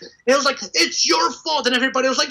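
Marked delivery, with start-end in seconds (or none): 0.7-1.7 mock angry blame. Angry Derek blaming him.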